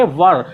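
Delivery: "वर" firm and loud, short central vowel; correct "were". Shown as a demonstration. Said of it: "इसको हम लोग क्या पढ़ेंगे".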